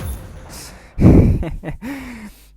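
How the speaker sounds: big high burst of laughter; loud laugh. Real, not acted. Vlogger bursts out laughing at his own quip. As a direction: crack up loudly.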